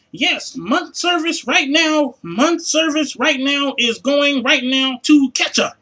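very slow, broken-English mock agent; imitating a call-center rep. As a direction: plod through the words like a confused phone rep. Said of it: "no English speaking motherfuckers".

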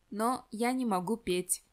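usual speaking voice

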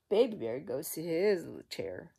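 ordinary delivery

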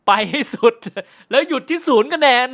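loud, high shout through laughter; real laughter bursting through a high yell; laughing shout. Real, not acted. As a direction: crack up while belting out the punchline. Joking catchphrase while laughing hard.

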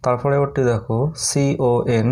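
usual speaking voice

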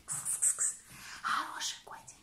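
soft whispered cat call; whispering to a pet. Real, not acted.

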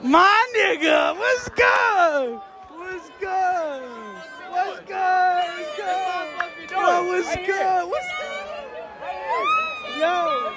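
hollering hello across the street; calling out. Real, not acted. Host calling out to people passing by.

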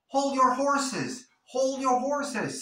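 high, loud playful hold-your-horses; colloquial. Shown as a demonstration. Teacher showing the casual way to say it. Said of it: "o si quieres ser más coloquial".

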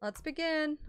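ordinary talk, nothing marked

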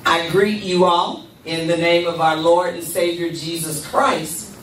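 ordinary talk

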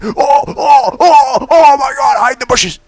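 loud, high alarmed yelling; frightened cries; panicked shout. Real, not acted. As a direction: scream at the sudden dragon. Dragon attacks suddenly.

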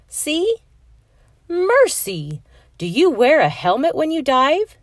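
high, loud startled cry; a lunch monitor's shocked Mercy; surprised. Acted. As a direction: gasp it out as if the dive scared you. Armadillo startled by pelican dive.

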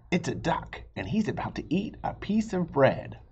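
lively, high storybook read-aloud; bright read-aloud with high character lines; reading to children. Acted. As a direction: voice the two arguing friends for kids. Reading picture book, two voices.

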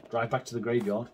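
ordinary talk, nothing marked

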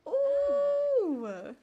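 loud, long, high "ooh"; excited high squeal. Real, not acted. Player watching a passionate kiss, loud squeal.

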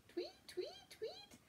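high breathy whispered tweets; whispered bird chirps. Acted. Bird chirps in kids' song.

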